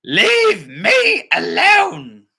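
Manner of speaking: high, loud, slow fed-up cry; hammed-up grandma shout. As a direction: bellow at the bears. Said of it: "the old woman shouted".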